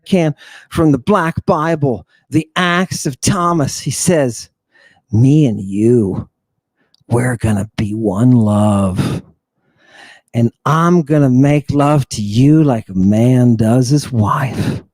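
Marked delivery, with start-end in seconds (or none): 5.1-14.8 much lower, slow creepy man's voice; low, slow, leering stranger voice. Retold or imitated. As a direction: drawl it out like a creep at the baths. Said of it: "This is a direct quote".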